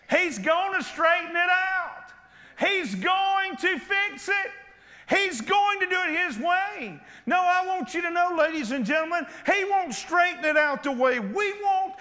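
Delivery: preacher's rising shout of assurance; fervent preaching. Acted. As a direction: proclaim it to the congregation.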